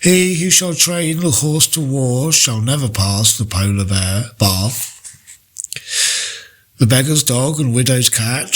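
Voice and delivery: ordinary talk, nothing marked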